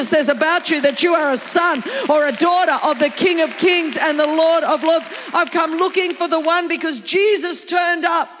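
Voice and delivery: rousing preacher in full flow; full-force preaching. Real, not acted. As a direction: preach it with building intensity.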